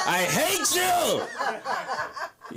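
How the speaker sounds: high, drawn-out, laughing shriek of hate; hammed-up squeal of hatred; mock outburst. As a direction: wail it out high through laughter. Comic yelling at lookalike actor.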